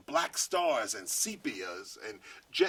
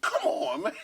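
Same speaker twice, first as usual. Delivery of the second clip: a way higher, louder burst of disbelief; astonished; 4 dB louder than usual. Retold or imitated. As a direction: exclaim it like you can't believe it. Boss amazed she knows Kevin.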